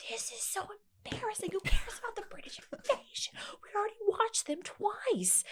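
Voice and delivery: put-on high whispered teen complaint; whiny teen self. A daughter recalling her embarrassed teen self, high and whispered. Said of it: "And I'm like".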